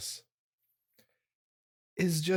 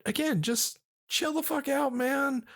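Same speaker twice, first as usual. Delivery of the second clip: mock exasperated; 10 semitones higher than usual. Cousins telling a raging player to calm down, mock exasperated. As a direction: urge him to calm down, with disbelief. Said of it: "And we're like".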